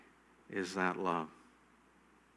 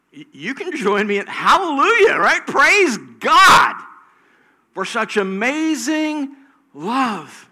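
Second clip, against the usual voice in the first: rising, louder preaching climax; fervent preaching; 9 semitones higher, 14 dB louder, slower than usual. Real, not acted. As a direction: build the sermon to full force, higher and louder. Calls congregation to praise.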